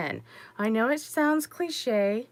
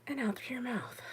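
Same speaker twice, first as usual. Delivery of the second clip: soft unvoiced whisper; guiding the viewer's breathing in a whisper; whispered breath cue; 2 semitones higher, 10 dB quieter than usual. Shown as a demonstration. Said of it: "Breathe in through your nose".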